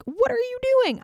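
high, loud What are you doing?; outraged king. Acted. Balak berates Balaam for blessing Israel, high and outraged. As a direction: yelp it up high, fed up. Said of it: "Balak is like".

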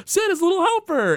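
put-on very high boyish call; Bart. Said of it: "And Bart's like".

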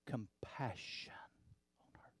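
breathy whisper on compassion. Acted. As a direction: drop to a hushed whisper. Preacher whispers the key word.

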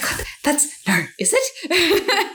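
breathy high disbelief, laughing; stunned disbelief. Retold or imitated. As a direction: gasp it out, not believing. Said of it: "I was like".

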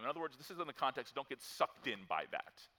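normal talking voice

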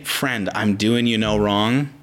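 low, slow, gentle reply; tender and kind. Acted. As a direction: answer the screamer gently, low and unhurried. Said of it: "to have a tone to say".

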